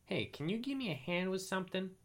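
high, squeaky small animal voice; little weasel voice. Acted. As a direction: talk like a tiny critter. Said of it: "said the little weasel".

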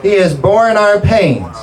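high, drawn-out scripture proclamation; emphatic preaching. Real, not acted. Preacher driving healing scripture into the congregation, emphatic preaching. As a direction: proclaim it, stretching the key words.

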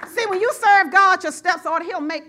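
loud, high preaching shout; rally the church; preaching at full force. Acted. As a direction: pastor roaring at congregation. Preaching climax.

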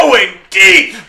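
loud, slow shouted announcer call; home run call. Pretend. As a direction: belt it out like a stadium announcer. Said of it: "that would be great in a home run call".

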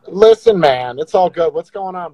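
ordinary talk

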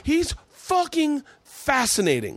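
drawn-out, high-pitched praise; exaggerated awe. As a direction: stretch it out like you're amazed. Mock awe about Dave.

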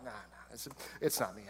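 Judas hushed and low, brushing it off; furtive whisper, pitched low; whispered denial. Retold or imitated. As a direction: whisper it so the others barely hear.

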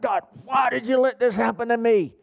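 high, strained re-enacted yell to God; retold yell. Preacher reliving yelling at God while driving, anguished yell. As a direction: cry it upward as if yelling at heaven. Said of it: "I was yelling out".

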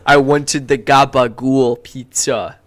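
slow, drawn-out New Jersey Italian "gabagool"; New Jersey Italian accent. Pretend. Repeating a friend's order in a dumb New Jersey Italian accent. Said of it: "dumb New Jersey Italian accent".

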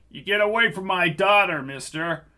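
a protective dad snapping at a stranger; angry father. Acted. Father confronts agents.

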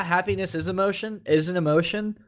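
usual speaking voice

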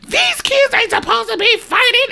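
very high, squeaky mimicry; mock her in a tiny high voice; mocked woman. Other mother excusing herself, mocking high-pitched imitation. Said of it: "and the woman was like".

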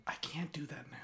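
whispered refusal; breathy whisper. Real, not acted.

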